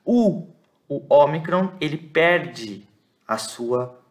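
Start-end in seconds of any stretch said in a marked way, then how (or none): 0.0-0.5 "U" as one pure oo; vowel sound demo. Shown as a demonstration. Teacher correcting omicron-upsilon reading, "U" as one oo sound. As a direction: say "U" as a single long oo. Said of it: "você vai pronunciar U".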